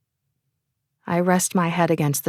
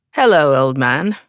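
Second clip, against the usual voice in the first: put-on louder, cheeky English accent greeting; 3 semitones lower, 5 dB louder than usual. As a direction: tease him in a posh English accent. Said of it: "he does so with an English accent".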